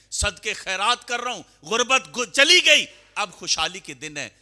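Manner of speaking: loud, rising sermon cry, peaking high and loud; preaching at full force. Acted. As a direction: build the congregation up to a ringing climax. Preacher builds to fervent peak.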